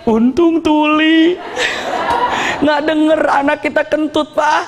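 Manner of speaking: high, laughing, drawn-out relief; laughing wife. Retold or imitated. Wife whispering to husband about the deaf teacher, laughing. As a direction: giggle it out, relieved. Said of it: "kata istrinya".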